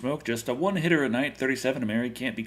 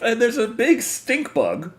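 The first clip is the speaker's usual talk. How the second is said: normal talking voice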